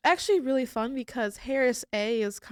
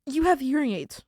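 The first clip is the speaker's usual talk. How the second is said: retold yelling; 4 semitones higher, 3 dB louder than usual. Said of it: "I am yelling across the room".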